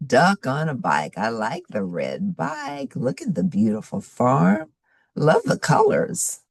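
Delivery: ordinary delivery, nothing marked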